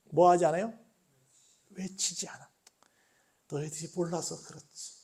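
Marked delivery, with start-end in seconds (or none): 1.7-4.9 hushed, understanding voice of Jesus; low-key, soft and forgiving; gentle, without shouting. Acted. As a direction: excuse them very softly, almost whispered. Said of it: "그들의 상황을 다 이해하시면서 외치지 않아요".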